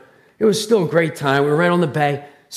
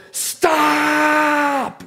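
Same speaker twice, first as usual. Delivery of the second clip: high, long-held yell; yelled stop; 10 semitones higher than usual. Retold or imitated. Preacher recalling warning running kids, high drawn-out yell. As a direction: yell it out high and stretch the vowel. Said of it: "I can always yell louder".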